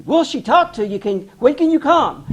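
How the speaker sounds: high-pitched, eager retort; anxious eagerness. Retold or imitated. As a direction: jump in eagerly, pitched well up. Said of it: "she was really anxious for me to get there".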